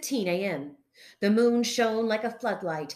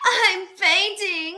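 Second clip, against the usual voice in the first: high, loud, drawn-out breathy gasp; 10 semitones higher, 8 dB louder, slower than usual. A narrator's reading. Frank after fleeing the fray, gasping. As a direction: gasp it out slowly as if about to drop. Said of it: "gasped Frank".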